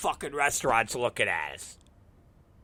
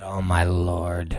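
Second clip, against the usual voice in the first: low, slow, drawn-out lament; weary dismay; 9 semitones lower, slower than usual. Retold or imitated. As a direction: sigh it out, deep and defeated. Weary reaction to the scene.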